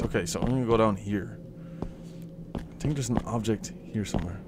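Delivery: very soft, low voice; hushed dread. Real, not acted. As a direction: creep along, barely daring to speak. Creeping through dark basement.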